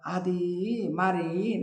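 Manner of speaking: soft, very slow hesitant mumble; a son's quiet, hesitant mumble; mumbling. A narrator's reading. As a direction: trail off, afraid to answer. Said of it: "నసిగాడు Buchibabu".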